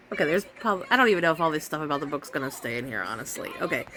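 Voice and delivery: ordinary talk, nothing marked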